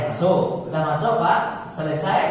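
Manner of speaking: normal talking voice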